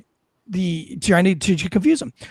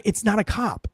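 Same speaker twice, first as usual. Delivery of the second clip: normal talking voice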